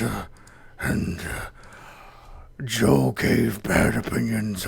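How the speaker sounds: very low, slow tree-creature voice; Treebeard. Pretend. Host sums up the podcast episode as an ancient tree, deep slow creature voice. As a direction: rumble it out ponderously, with long pauses between phrases. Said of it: "Should I do my Treebeard voice now?".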